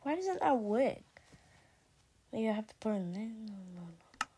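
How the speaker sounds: ordinary talk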